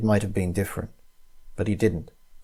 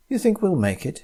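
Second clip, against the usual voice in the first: high, hopeful question; cheerful question; 8 semitones higher than usual. Acted. Tina to Tom at the clearing, cheerful and hopeful. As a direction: ask brightly about the summit. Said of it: "she asked".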